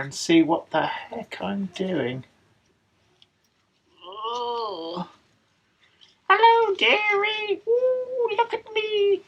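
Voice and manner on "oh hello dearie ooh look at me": put-on high, slow old-lady singsong; elderly singsong. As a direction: coo like a Victorian granny. Dressed in bonnet, Victorian voice.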